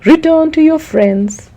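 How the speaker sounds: proclaiming king. Acted. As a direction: announce it to the whole court. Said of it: "the king proclaimed".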